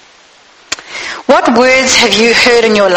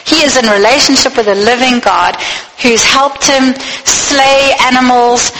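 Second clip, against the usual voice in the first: ordinary talk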